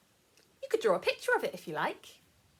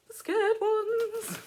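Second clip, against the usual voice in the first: mock scared; 11 semitones higher, 6 dB louder, much slower than usual. Listing feelings at story's party.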